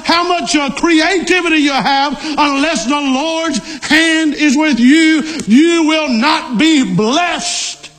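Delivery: high-pitched, emphatic pulpit delivery; fervent preaching. Real, not acted. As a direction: drive each clause home, pitched high, stretching the last words.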